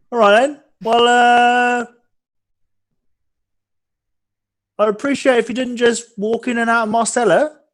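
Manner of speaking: high, loud innkeeper voice. Acted. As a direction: play the gruff innkeeper. Innkeeper NPC voice.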